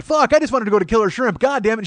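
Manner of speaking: loud, high re-enacted rant; frustrated outburst. Comic replaying his rant from the show, frustrated.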